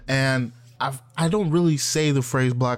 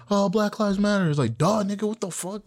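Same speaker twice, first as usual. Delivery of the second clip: higher, incredulous duh tone; mock disbelief; 7 semitones higher than usual. Reacting to an obvious slogan, high incredulous scoff.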